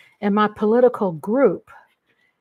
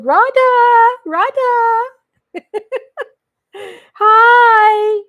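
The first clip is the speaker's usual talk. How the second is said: much higher, louder gleeful calls; excited shouting; 12 semitones higher, 10 dB louder, much slower than usual. Real, not acted. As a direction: shout your own name with glee. Reading/greeting excitedly.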